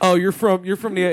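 put-on Pennsylvania neighborhood accent; local Pennsylvania accent. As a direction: rib the locals in their own Pennsylvania accent. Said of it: "They talk like that over there".

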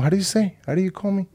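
high-pitched mock indignation; indignant challenge. Coworker challenging the man who called her corazón, indignant. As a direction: imitate an affronted coworker questioning a word.

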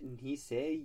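ordinary delivery

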